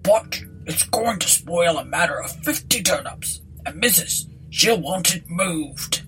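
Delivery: rustic landlord, mildly aggrieved; country voice, slowing at the end; bothered landlord. Acted. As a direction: grumble like a troubled village innkeeper.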